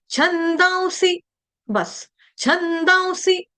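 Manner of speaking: high छंदांसी cut off on a short सी; slow chhandaun, then brief si in छंदांसी; short final vowel. Shown as a demonstration. Teacher showing C for cat, short-ending chant. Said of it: "यहाँ पे आपको सी कैसे बोलना है".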